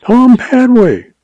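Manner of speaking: frightened pleading cry. A narrator's reading. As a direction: plead desperately. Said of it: "pleaded the agent".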